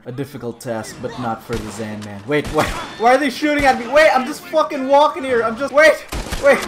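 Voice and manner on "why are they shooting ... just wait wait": high, loud alarm; panicked protest. Real, not acted. Innocent walker to guards shooting at him, panicked. Shot at unexpectedly.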